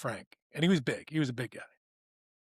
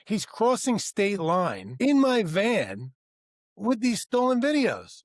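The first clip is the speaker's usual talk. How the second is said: slow, much higher and louder, every word punched; outraged emphasis; 7 semitones higher, 4 dB louder, much slower than usual. Retold or imitated. Telling HR why the driver must go, outraged. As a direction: spell out the outrage slowly. Tells HR why he'll fire him.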